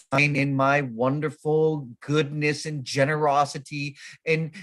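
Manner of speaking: slow, grand self-congratulation; mock magnanimity. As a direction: proclaim your own goodness slowly and pompously. Mocks his own self-satisfied generosity.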